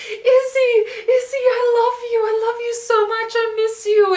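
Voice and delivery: put-on high, loud drunken wail. Drunk Simon wailing his love at Izzy's house. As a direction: whine it out like a lovesick drunk. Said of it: "gets really drunk ... starts yelling".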